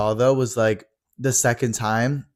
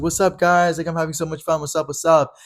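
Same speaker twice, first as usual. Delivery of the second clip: hyped greeting; 6 semitones higher than usual. Retold or imitated. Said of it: "jumped and was like".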